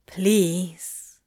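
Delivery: understated, drawn-out plea; begging. A narrator's reading. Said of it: "Crowley begged".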